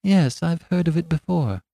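high, light and absent-minded; vague yes. Acted. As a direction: answer vaguely, far away. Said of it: "with the same strange vagueness of manner".

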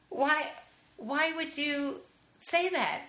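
pitched well up, halting and slow; incredulous. Retold or imitated. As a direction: ask it stunned and a bit hurt. Retelling own shocked question.

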